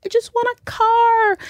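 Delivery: high, whiny I just want a car; whiny younger self. Retold or imitated. Mocking her younger self to the co-host, high and whiny. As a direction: whine it like an exhausted kid.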